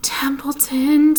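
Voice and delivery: slow, high, despairing call; desperate. A narrator's reading. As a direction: plead his name in desperation. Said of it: "in desperation".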